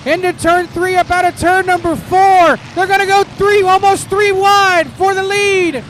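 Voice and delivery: lively live race call; motorsport commentator. Real, not acted. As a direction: call the laps as they unfold.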